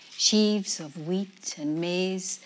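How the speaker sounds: ordinary delivery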